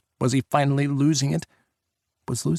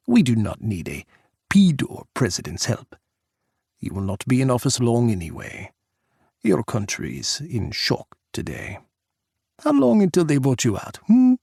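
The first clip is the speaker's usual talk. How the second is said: put-on lightly accented Russian leader needling; Russian president taunting Jack, Russian accent. Pukov's accented voice.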